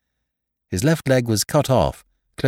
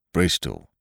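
gruff sailor; 8 semitones lower than usual. A narrator's reading. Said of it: "said Tom".

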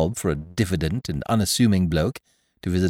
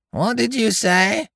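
put-on much higher, slow old-lady query; suspicious; 12 semitones higher, slower than usual. A narrator's reading. Deaf old aunt suspiciously asking the guest to repeat. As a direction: ask it with narrowed suspicion. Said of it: "asked the exhibit suspiciously".

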